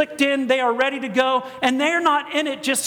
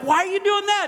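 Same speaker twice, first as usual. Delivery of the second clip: higher-pitched retold protest; acted protest; 9 semitones higher than usual. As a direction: question the attendant in mock alarm. Retells protesting at the safety check.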